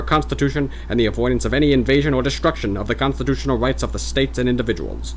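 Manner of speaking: put-on old-timey Mid-Atlantic radio voice; loud, formal Mid-Atlantic orator; Mid-Atlantic accent. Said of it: "mock Mid-Atlantic voice".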